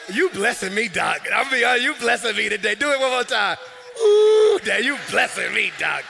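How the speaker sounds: excited praise. Real, not acted. Preacher praising the organist's playing.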